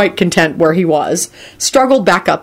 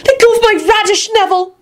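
high-pitched indignant squeal; angry squeal. A narrator's reading. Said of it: "Luna squealed angrily".

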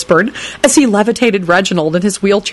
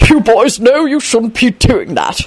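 higher, slower amused scolding; fond chuckle; 5 semitones higher, slower than usual. A narrator's reading. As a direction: chide them laughing. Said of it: "Richard chuckled fondly".

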